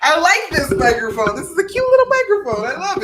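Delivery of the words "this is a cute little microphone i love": high, fast cutesy gush. Real, not acted. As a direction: coo over the little microphone. Gushing over new mic.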